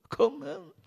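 sudden high outburst; shocked cry. A narrator's reading. Bouc hears the train is full, shocked cry. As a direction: cry it out in disbelief. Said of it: "gridò Monsieur Buck".

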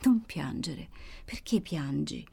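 a hint of a strange, grave voice, slow at the end; lightly voiced wounded woman, low and solemn; grave deep voice. Wounded Carmela comforts her sister, grave and deep. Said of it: "con una voce singolare, grave, profonda".

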